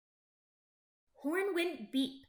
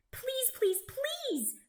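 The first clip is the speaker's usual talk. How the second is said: high-pitched eager pleading; begging; 12 semitones higher, slower than usual. A narrator's reading. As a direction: beg for it, voice way up high. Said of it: "begged Racer Red".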